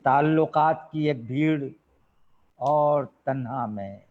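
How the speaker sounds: measured ghazal recital with long pauses; poetry recitation. Acted. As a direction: recite the couplet phrase by phrase to an audience. (ग़ज़ल पढ़ने का ठहरा हुआ अंदाज़)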